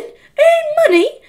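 appalled disbelief. Acted. Henry appalled at earning money.

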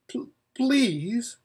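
timid stammered plea; a shy gentle mare faltering. A narrator's reading. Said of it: "said Hwin very shyly".

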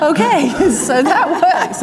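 tag amused mock acceptance. Mock reaction to psychopath finding.

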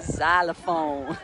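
"Xylophone" drawn out slowly as "zalophone"; performed pronunciation. Shown as a demonstration. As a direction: say "Xylophone" carefully on request. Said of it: "go on, do it".

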